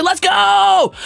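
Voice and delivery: very high, drawn-out whoop; hype cheer. Acted. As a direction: shout it like a celebrating fan. Viewer cheer read aloud.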